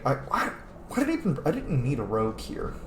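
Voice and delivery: usual speaking voice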